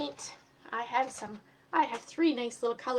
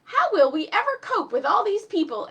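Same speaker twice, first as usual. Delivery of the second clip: higher, louder anxious husband; worried question; 8 semitones higher, 9 dB louder than usual. Acted. Eddie frets to Fay about tourists, higher and louder. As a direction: Eddie fretting about the crowds. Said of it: "Eddie asked".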